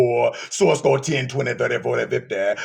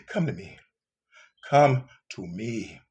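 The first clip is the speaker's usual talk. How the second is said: soft tender call; 9 semitones lower, 8 dB quieter than usual. Acted. Gentle call from God.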